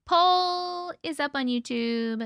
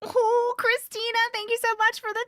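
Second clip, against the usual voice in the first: excited thanks; 9 semitones higher than usual. Real, not acted. Thanking a viewer for a gift.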